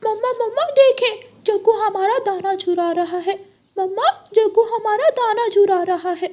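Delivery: put-on high, loud child's cry; child shouting for mom. Little Kuku shouts to mom about the stolen grain, high and loud. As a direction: shout to mom to catch the thief. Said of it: "Kuku शोर मचाता है".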